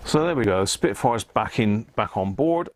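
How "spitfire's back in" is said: ordinary talk, nothing marked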